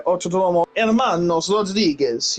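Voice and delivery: "Autodromo Hermanos Rodriguez" rolled out in a Mexican Spanish accent. Shown as a demonstration. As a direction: give the track name its full Mexican Spanish flavour. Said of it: "You've gotta say it in a Mexican accent".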